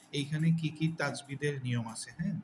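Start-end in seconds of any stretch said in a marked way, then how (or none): none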